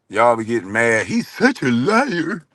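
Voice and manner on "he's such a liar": mock him as a slow whiner; mocking whiny impression. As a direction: stretch it out in mocking wounded falsetto. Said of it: "Sounding like hoes".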